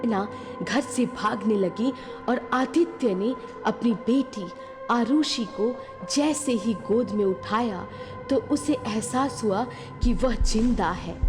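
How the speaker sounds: plain reading voice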